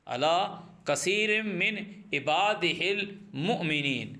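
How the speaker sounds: کثیر drawn out slowly, words spaced apart; slow it right down so students catch each word; slow repeat. Shown as a demonstration. Teacher repeating the verse for students, slow and spaced.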